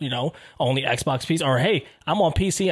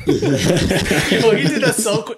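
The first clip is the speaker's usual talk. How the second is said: high, laughing tease; real laughter breaking through the words; 6 semitones higher, 5 dB louder, much slower than usual. Real, not acted. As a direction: crack up while talking. Cracks up at co-host's slip.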